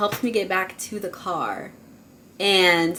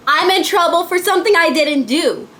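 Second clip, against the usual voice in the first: high, whiny retelling of tearful panic; mock crying protest; 7 semitones higher, 4 dB louder than usual. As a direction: wail it lightly as an unfair accusation. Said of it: "And I just start crying".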